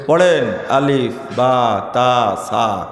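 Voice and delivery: slow, measured alif, ba, ta, sa; reciting Arabic letters. Shown as a demonstration. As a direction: sound out each letter like a teacher. (ধীরে ধীরে আলিফ বা তা সা উচ্চারণ)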